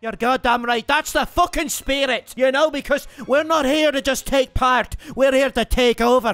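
cocky mock Irish accent, lightly done; a gamer playing brash Irish fighter Conor McGregor; swaggering put-on Irish brogue. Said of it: "My name is fucking Conor McGregor".

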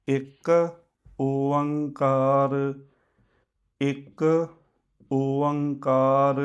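ਇੱਕ short, then ਓਅੰਕਾਰ long and drawn out, again and again; slow repeated recitation. Shown as a demonstration. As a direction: recite ਇੱਕ ਓਅੰਕਾਰ slowly like a practice chant. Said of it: "repeated chant of the word". (ਇੱਕ ਓਅੰਕਾਰ ਹੌਲੀ ਹੌਲੀ ਦੁਹਰਾਉਣਾ)

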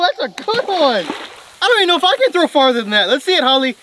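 normal talking voice

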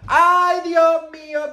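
high, loud Spanish gasp of disbelief; shocked exclamation. Real, not acted. Vlogger reacting to friend's gossip, loud shocked cry. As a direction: blurt it out, scandalized.

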